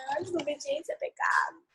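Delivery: imitating a child who already knows it; child's rote answer. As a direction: mimic a kid reciting a familiar lesson. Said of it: "Aí ela já fala assim".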